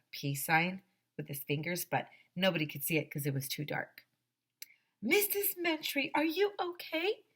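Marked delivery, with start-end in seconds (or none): 5.0-7.2 very high, questioning call; high-pitched storybook worry; concerned calling. A narrator's reading. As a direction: check on her in a high, anxious voice. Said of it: "Mister Klutz asked".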